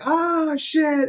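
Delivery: high, loud dismayed yelp; shocked curse. Retold or imitated. Seeing the missing finger, a high shocked curse.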